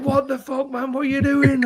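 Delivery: high-pitched mock bewilderment; startled workmate. Liverpool fan to his celebrating workmate, startled protest. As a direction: voice jumps right up in disbelief. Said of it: "and he's like".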